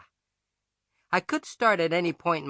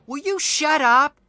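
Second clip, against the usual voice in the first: annoyed shout; 10 semitones higher, 8 dB louder than usual. A narrator's reading. Narrator snaps at classmate.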